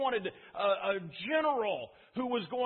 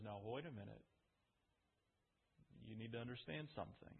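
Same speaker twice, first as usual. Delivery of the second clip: soft and low, hushed; gentle Jesus; 11 semitones lower, 15 dB quieter than usual. Acted. Jesus gently stopping his disciples, soft and low. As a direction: draw them in quietly.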